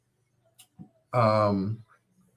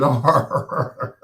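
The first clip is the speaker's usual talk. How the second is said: slow words breaking into a laugh; 4 dB louder than usual. Real, not acted. Telling listeners about the movies, laughing through the words.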